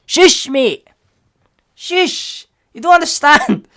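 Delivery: high, loud, comic scolding; indignant squawk of a fed-up fan; exasperated shushing. Acted. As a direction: shut the commenter up, hammed-up. Acts hushing an ignorant commenter.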